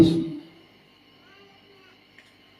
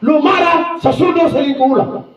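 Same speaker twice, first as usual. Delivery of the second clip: raised, pleading-stern warning; lightly acted plea to his wife; retold warning to wife; 6 semitones higher than usual. Pastor warning his wife not to get angry, mock stern. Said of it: "saya bilang sama istri".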